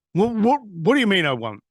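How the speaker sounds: imitating an indignant, startled caller; taken aback. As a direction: balk at being refused. Retold caller balks at refusal.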